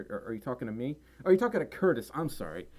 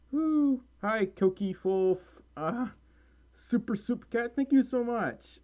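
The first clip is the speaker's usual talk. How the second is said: much higher, slow, bubbly hellos; excited greeting; 8 semitones higher, slower than usual. Real, not acted. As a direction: greet each fan with a squeal.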